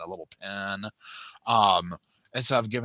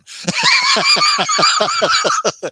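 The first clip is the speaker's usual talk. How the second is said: loud high laughter; 13 dB louder than usual. Real, not acted. Laughs at own joke.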